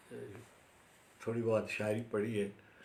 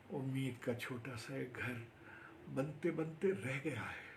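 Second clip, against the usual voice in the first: subdued reprise of the refrain; soft closing Urdu recital; poetry recitation; 6 dB quieter than usual. Acted. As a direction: let the verse down gently, measured phrases. Recites own Urdu poem.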